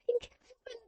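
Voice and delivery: very high, thin, hushed cry; acted rapture at being rescued; ecstatic cry of relief. A narrator's reading. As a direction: cry out high but small, overcome with relief. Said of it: "cried the girl in an ecstasy".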